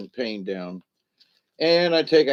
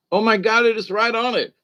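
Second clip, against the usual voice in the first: ordinary delivery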